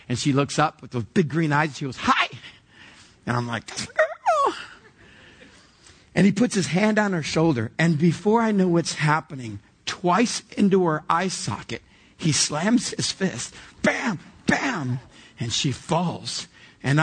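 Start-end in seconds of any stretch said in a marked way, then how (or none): 2.0-2.3 put-on a tiny girl's high, sweet hello; imitating a little girl's high hi. Daughter greets boy sweetly. 3.6-4.1 mock proud squeal; proud high squeal. Proud dad aside. 13.8-15.0 punch sounds. Retold or imitated. Imitating the punches.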